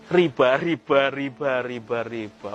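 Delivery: slow repeated word, fading away; pengulangan dramatis. Real, not acted. Kata diulang sebagai penekanan dramatis. (pelan, makin lirih dan turun)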